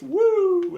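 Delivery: high, loud drawn-out whoop; hammed-up catchphrase holler; wrestler's woo. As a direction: let out a ringing showman's woo. Flair catchphrase in retold quote.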